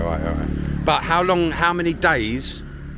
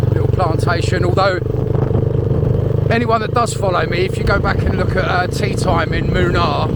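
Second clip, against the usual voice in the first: raised voice over engine noise; shouting over noise; 5 semitones higher, 6 dB louder than usual. Real, not acted. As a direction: talk up loud against the wind. Talking over road noise, riding.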